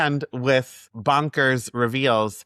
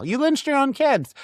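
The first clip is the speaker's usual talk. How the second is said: mock outraged; 13 semitones higher than usual. Mock accusing phone call quote.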